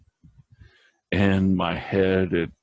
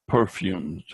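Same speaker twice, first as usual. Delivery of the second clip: ordinary delivery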